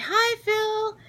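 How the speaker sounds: very high, giddy greeting; starstruck hello. Retold or imitated. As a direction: squeak a starstruck hi. Greeting Phil on meeting him.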